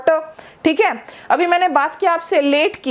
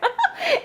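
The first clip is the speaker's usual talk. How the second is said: giggle; 5 dB quieter than usual. Acted. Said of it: "imagined fiancée's delight".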